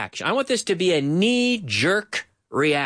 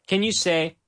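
normal talking voice

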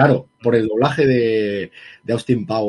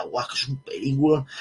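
mock awe; 9 dB quieter than usual. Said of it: "la gente tan contenta".

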